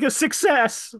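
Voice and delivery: high-pitched triumphant puppet voice; cheering puppet. Retold or imitated. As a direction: squeak it out as a proud little puppet. Cheering puppet's line after others mourn.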